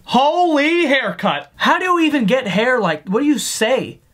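ordinary talk, nothing marked

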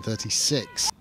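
ordinary delivery

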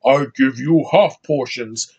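slow, put-on alien trader voice; mock movie-character voice, drawn out; film character impression. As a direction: play a film creature haggling, slowly. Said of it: "useless cancer face is talking to Simon Pegg's characters like".

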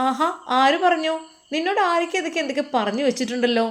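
high, animated challenge; indignant motherly elder. Acted. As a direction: question him in lively disbelief.

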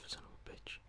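ordinary talk, nothing marked

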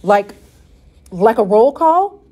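a scared question, dreading the answer; frightened. A narrator's reading. Father frightened at the thought of roll call, asking. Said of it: "He looked even more frightened".

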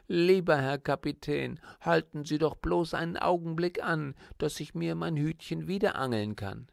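put-on a high, pleading little girl's voice; pleading child. Girl begs the captain to stop the boat, high pleading child voice. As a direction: beg the captain sweetly and urgently.

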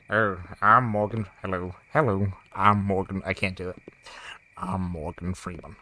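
low, soft Morgan Freeman narration; lightly voiced deep narrator. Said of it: "Let's do more of a narrator voice".

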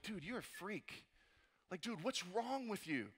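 hushed, slow putdown; disapproving friend. Acted. Friends reacting to a student's Bible club, quietly disapproving. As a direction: drop the volume and draw out the disdain. Voicing mocking friends at school.

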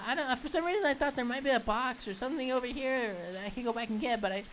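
usual speaking voice

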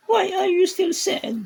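squeaky very high pet voice. Real, not acted. Baby talk to sad cat. (napakataas na boses sa pusa)

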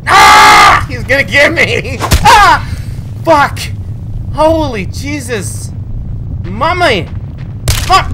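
loud high panicked yells; panicked screaming. Real, not acted.

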